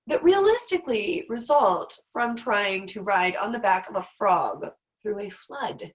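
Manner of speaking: usual speaking voice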